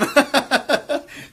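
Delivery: squealing high, loud laugh; a high burst of laughter. Real, not acted. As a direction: crack up at the punchline. Laughs again at the joke.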